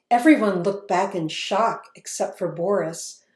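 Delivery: ordinary delivery, nothing marked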